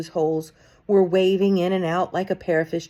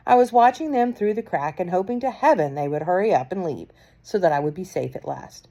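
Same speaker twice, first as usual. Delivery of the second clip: plain reading voice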